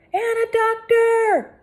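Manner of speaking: slow high loud echoed call. A narrator's reading. Inspector Locke repeating the call for a doctor. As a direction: echo the demand with authority. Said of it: "The voice belonged to Inspector Locke".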